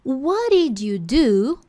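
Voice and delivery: slow, drawn-out question; slow demo. Shown as a demonstration. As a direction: spell the question out slowly. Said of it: "slow teaching demo". (pergunta lenta e bem articulada)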